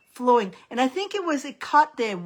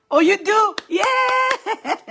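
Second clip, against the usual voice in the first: a much higher, louder delighted cry; 9 semitones higher, 4 dB louder, much slower than usual. Real, not acted. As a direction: answer with sudden delight. Delighted reply to a viewer.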